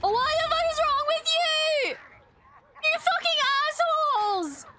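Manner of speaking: appalled, high-pitched scolding; outraged. Real, not acted. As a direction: wail it at the villains on screen. Outraged at villains on screen.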